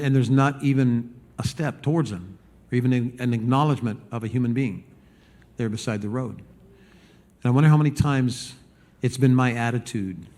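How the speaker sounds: usual speaking voice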